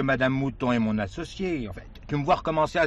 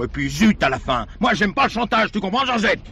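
louder and higher, fed up with blackmail; angry outburst; 6 semitones higher, 5 dB louder than usual. Acted. Man refusing his mistress's ultimatum, angrily raised. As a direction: snap back at the mistress, done with it. Émile loses temper with Georgette.

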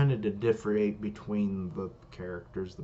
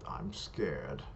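low, soft confession of fear; hushed admission; 7 semitones lower, 6 dB quieter than usual. Acted. Facing his rising power, hushed admission of fear. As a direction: let it drop low and quiet. Character admits fear of opponent.

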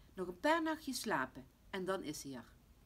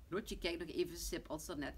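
plain reading voice

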